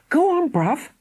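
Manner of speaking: urging. Acted. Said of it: "Philly urged".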